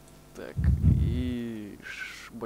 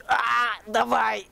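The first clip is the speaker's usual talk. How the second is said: high, loud cry of daring; excited shout; 9 semitones higher, 6 dB louder, much slower than usual. Real, not acted. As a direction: yell as you leap. Launching himself off the cliff.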